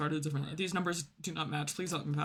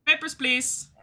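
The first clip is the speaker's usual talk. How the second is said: loud, higher clipped demand; stern inspector; 8 semitones higher, 5 dB louder, slower than usual. Pretend. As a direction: bark it out like a border guard.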